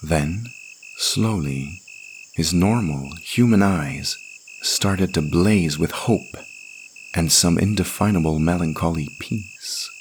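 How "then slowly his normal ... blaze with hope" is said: usual speaking voice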